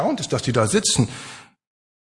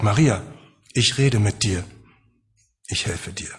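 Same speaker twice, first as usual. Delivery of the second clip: loving comfort; 7 semitones lower than usual. Acted. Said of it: "in einer tröstenden, liebevollen, empathischen Art".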